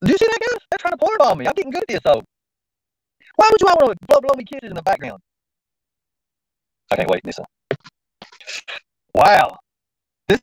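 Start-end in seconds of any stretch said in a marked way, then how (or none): 0.0-5.2 much higher, outraged glee; high excited disbelief. Real, not acted. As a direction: show the chat, pitched way up. Catching porn bombers excitedly.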